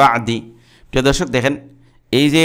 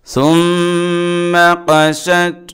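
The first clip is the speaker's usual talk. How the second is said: Quran recitation; 2 semitones higher, much slower than usual. Acted. Teacher recites the verse to students, slow melodic tajweed chant. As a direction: intone each word slowly, like a reciter.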